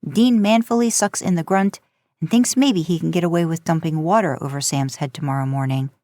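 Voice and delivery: plain reading voice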